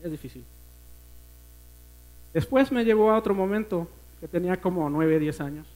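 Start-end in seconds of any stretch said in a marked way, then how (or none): 0.0-0.4 quiet, lower, struggling to speak; the words barely come out after tears; real emotion, voice nearly gone; choked up. Real, not acted. Long silence, emotion takes over.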